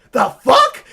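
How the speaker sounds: sudden high shocked yell; jolted by the mistake on screen, blurting it out loud; real high-pitched disbelief; shocked outburst. Real, not acted. Sees his own approve mistake.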